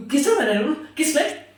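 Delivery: high-pitched, worked-up demand; outraged question, pitched well up; indignant protest. Acted. Boyfriend challenging the no-pointing rule, high indignant protest.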